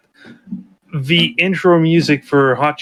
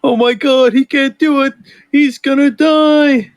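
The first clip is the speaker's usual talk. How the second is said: high, drawn-out pretend dread; mock alarm; 11 semitones higher, 4 dB louder, slower than usual. Onlooker watching him fail the challenge, hammed-up high alarm. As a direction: wail it like a horrified onlooker.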